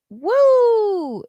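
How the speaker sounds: high, loud drawn-out woo; excited whoop. Real, not acted. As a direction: let out a big high whoop. Exclaims after the retold event.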